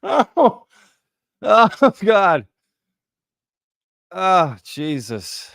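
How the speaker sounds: high, loud howls of laughter, then a slow drawn-out sigh; helpless laughter. Real, not acted. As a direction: lose it completely, then wind down. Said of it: "I needed that laugh".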